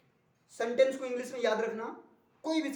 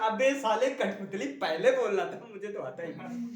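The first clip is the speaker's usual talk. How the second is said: louder teasing jeer among friends; mock friend's jeer; 4 dB louder than usual. Friend teasing about a puppet dance, loud mock jeer. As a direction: rib a friend loudly.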